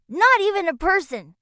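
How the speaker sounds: ordinary delivery, nothing marked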